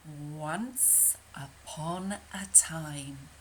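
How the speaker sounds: slow, breathy whispered "Once Upon a Time"; hushed storybook opening. Shown as a demonstration. Storyteller to children, slow hushed whisper. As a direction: whisper it slowly like a bedtime story.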